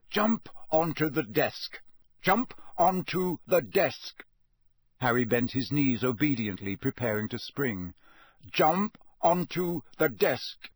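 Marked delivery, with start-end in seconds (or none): 0.1-4.2 high, slow, hypnotic order; spaced-out words echoing in an empty head; echoing inner command. A narrator's reading. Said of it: "echoing in some distant chamber of his empty brain". 8.5-10.7 high, slow, hypnotic order; spaced-out words echoing in an empty head; echoing inner command. A narrator's reading. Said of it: "echoing in some distant chamber of his empty brain".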